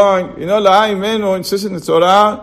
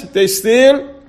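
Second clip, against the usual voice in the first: ordinary talk, nothing marked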